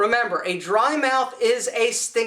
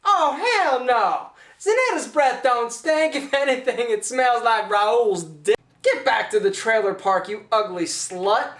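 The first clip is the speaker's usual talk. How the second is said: sassy woman voice. Acted. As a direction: snap back with attitude. Sassy retort as Zanetta.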